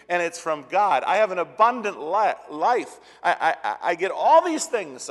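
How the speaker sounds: rising to a loud, high preaching peak; fervent preaching. Real, not acted. Pastor exults to the congregation about God's free gifts, fervent. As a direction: lift the room with joy.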